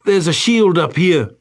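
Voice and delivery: high, loud excited yell in a goofy voice; klutz shouting. Retold or imitated. Klutz trooper calling down to his squad, high excited shout. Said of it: "that klutz shouting".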